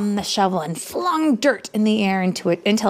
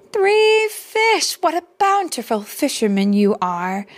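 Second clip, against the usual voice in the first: high, loud exclamation of praise; mother praising her son, delighted; 8 semitones higher, 3 dB louder than usual. A narrator's reading. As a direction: gush over the child's catch. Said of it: "said Mama".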